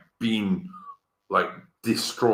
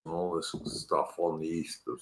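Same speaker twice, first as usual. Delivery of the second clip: ordinary talk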